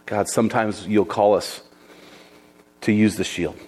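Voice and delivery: low, hushed prayer to God; closing prayer. Acted. Closing prayer after the sermon.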